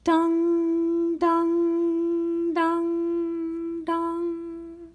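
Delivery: high ringing bell imitation, fading; church bell dong. Narrator imitates church bells clanging, high ringing dong. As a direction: ring out each dong like a bell. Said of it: "Church bells clanged".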